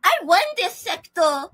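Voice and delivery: put-on high, slow baby voice; baby talk. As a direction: coo it like a smug baby. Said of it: "Baby talk".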